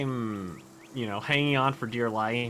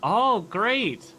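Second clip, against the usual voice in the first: excited; 10 semitones higher, 4 dB louder than usual. Real, not acted. Relief at buoyant drum.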